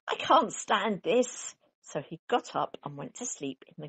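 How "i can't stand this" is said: slow, drawn-out complaint; exasperated. A narrator's reading. Mister Bear, sleepless, complaining slowly and wearily. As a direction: stretch it out, fed up. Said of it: "said Mister Bear".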